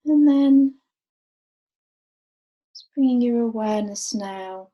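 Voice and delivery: calm, unhurried meditation voice with long pauses; guided meditation. Real, not acted. Teacher guiding a group to rest awareness on the breath, guided meditation. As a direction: guide them gently, letting each phrase settle and fall away.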